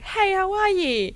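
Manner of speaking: high, slow, sing-song hello from a shop assistant; bright customer-service voice pitched far up; cheery shop greeting. Retold or imitated. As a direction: chirp it way up like an overly friendly Aussie clerk. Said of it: "they're like".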